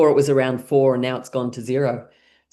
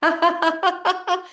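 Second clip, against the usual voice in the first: forced laughter. Shown as a demonstration. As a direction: laugh it out on purpose, high up. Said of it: "So let's pack our suitcases".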